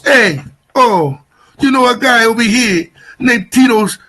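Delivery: a hint of a Jersey Italian uncle on the phone; Tony Danza-style uncle. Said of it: "he talks like Tony Danza".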